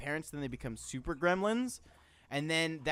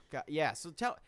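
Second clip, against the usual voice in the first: normal talking voice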